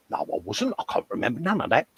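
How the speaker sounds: lightly voiced mum, soft and fast in denial; a hint of an old mum brushing it off; mum denying it. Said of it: "she's sitting there now going".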